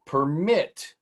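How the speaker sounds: ordinary delivery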